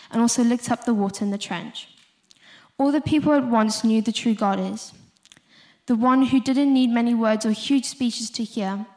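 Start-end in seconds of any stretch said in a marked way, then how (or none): none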